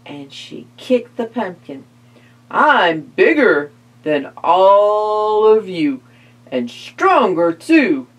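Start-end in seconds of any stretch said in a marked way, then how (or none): 2.5-8.1 big, menacing mummy voice; looming mummy. Acted. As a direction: tower over them like a mummy. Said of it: "loomed the mummy".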